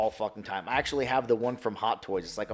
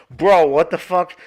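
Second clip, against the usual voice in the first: much louder, slow stunned shout; amazed shout; 6 semitones higher, 14 dB louder, slower than usual. Retold or imitated. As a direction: yell it at the screen in awe. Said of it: "And I was like".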